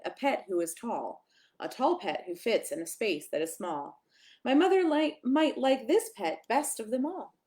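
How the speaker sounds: plain reading voice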